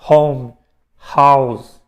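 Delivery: "home" slow, "house" punched with a breathy h; "house" said loud with a rough aspirated h. Shown as a demonstration. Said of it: "som de R forte".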